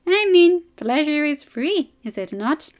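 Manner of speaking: teasing princess. Acted. Arianne mocking Dany.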